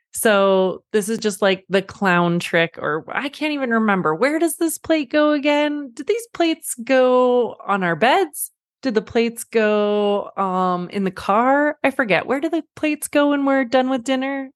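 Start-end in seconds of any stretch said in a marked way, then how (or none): 3.1-14.5 higher, mock-puzzled questions; playing dumb. Mother to kids after dinner, hammed-up confusion. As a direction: act like you truly forgot. Mother clowning about plates.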